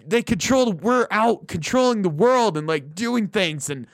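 high, pompous mock manly boast; mock macho bravado. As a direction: puff up like a proud caveman. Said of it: "they're like".

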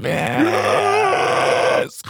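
slow belched voice demo; burping the words. Shown as a demonstration. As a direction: burp the line out slowly. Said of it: "It's really just a voice".

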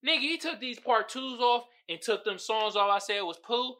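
ordinary talk, nothing marked

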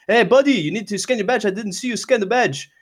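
mock the coworker demanding the badge; coworker's challenge. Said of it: "he's like".

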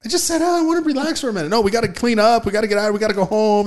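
high, whiny retelling of the rush; exasperated mock whine. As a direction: sound put-upon and indignant. Retelling being hurried out.

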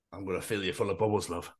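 quiet, leering murmur; creepy low voice. Pretend. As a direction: creep it out softly. Creepy West Ham fan voice.

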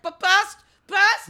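high, loud spluttering gasps under pouring water; a retold choking splutter, high and loud; mock sputtering. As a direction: imitate choking on water through a towel.

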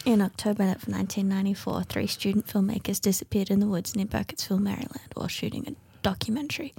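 hushed, ominous movie-trailer narration; horror trailer voice. Pretend. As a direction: whisper it like a spooky trailer. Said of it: "my trailer voice".

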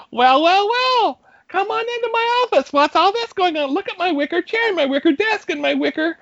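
high-pitched mock manager welcoming coworkers into her office; Donna impression. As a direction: play the prim manager Donna inviting them in. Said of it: "you did already hear a little bit of it".

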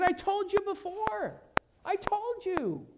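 high, slow, drawn-out retort; insistent reply. Retold or imitated. Jesus answering people who keep asking who he is, insistent.

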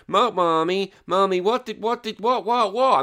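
put-on high, insistent child's stammer; imitated little girl. Little girl asking mommy what was said, imitated high pestering. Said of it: "my little girl hiccups is going".

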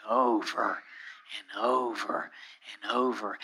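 low, hushed, monotonous repeats; wearily repetitive, almost whispered; droning repetition. Shown as a demonstration. Illustrating endlessly repeated prayer.

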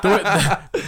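usual speaking voice

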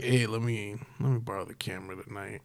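low, soft growly impression; Vin Diesel voice. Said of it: "And Vin Diesel's like".

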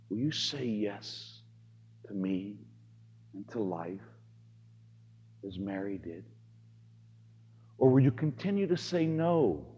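very soft, hushed plea; soft voice of God. Acted. Priest voicing God gently asking, very soft. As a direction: ask it quietly, barely above a breath. God's voice quoted to congregation.

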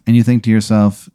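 ordinary talk, nothing marked

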